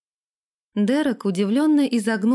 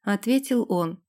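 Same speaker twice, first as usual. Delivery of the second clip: ordinary delivery, nothing marked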